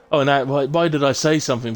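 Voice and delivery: exasperated exclaim. Real, not acted. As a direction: cry out at the jinx. Safety car comes out after jinx.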